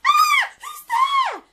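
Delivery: high, loud startled scream; frightened scream. Acted. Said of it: "screamed Granny".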